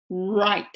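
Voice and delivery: "right" with a rounded u-like onset; English R. Shown as a demonstration. Teacher repeating the right example, rounded R. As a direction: pucker into the R of "right". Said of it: "こっちは".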